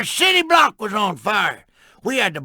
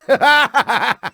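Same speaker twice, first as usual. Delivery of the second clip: hearty laugh. Real, not acted. Laughing at his own tall tale.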